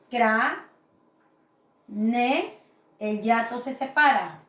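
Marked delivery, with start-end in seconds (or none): 0.1-2.6 Cra- then ne, each syllable split off with a pause. Shown as a demonstration. Teacher splitting a word into syllables for the class. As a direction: break cráneo into its syllables slowly. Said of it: "sería de la siguiente manera".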